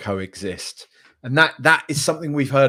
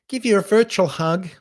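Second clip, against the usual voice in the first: higher-pitched, odd American accent; weird American accent; 8 semitones higher than usual. Real, not acted. Streamer offers a gutted viewer a hug, weird American accent. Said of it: "said it in a weird American accent".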